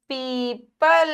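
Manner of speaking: पीपल very slow, ee held long; long drawn vowel. Shown as a demonstration. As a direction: stretch पीपल out syllable by syllable. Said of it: "हल्का सा हम खींचते हैं इसको". (पीपल को खींचकर, धीरे-धीरे बोलें)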